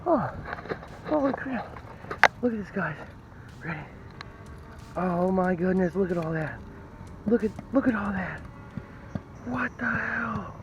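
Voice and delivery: high and soft, breathless wonder; hushed amazement at a pile of coins; awed excitement. Real, not acted. Detectorist gawks at the coin pile, breathless and awed.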